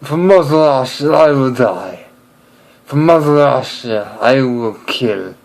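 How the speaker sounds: mock-angry Russian accent; heavy Russian accent, grimly patriotic. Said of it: "it's just very angry all the time".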